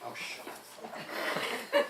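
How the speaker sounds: breathy, slowed, joking shut up; mock hushed brush-off. Real, not acted. To the co-reader cutting in, a hushed playful brush-off. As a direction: wave off a friend's heckle in a hushed tease. Teasing the co-reader who interrupts.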